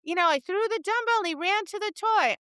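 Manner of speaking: high, fast protest from a put-out student; complaining student. Retold or imitated. Student complaining the dog ran to the toy, high and fast. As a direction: whine it like a student whose dog grabbed the toy.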